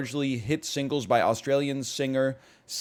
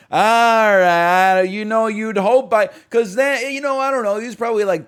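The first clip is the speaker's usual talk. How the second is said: higher and louder, half laughing in disbelief; exasperated outburst; 9 semitones higher, 10 dB louder than usual. Real, not acted. Streamer exasperated at chat's Brody story, loud outburst. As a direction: come on, really?.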